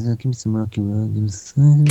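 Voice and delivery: a lightly put-on mumbling star voice; a hint of Brando ordering ice cream; Marlon Brando impression. Retelling a Brando story, lightly impersonating him. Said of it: "the way I said".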